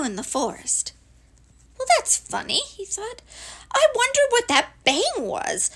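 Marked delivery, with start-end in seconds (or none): 1.8-2.6 high-pitched puzzled small creature; high, baffled little character voice; puzzled little Piglet. Acted. Piglet puzzled after his fall. 3.7-5.7 high-pitched puzzled small creature; high, baffled little character voice; puzzled little Piglet. Acted. Piglet wonders about the bang.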